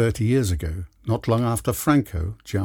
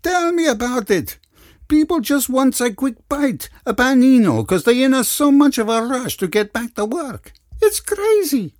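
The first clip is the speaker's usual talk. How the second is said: put-on much higher, loud Italian-accented rant; exasperated Italian restaurateur; 7 dB louder than usual. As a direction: throw up your hands like an Italian padrone. Gianni's voiced lines.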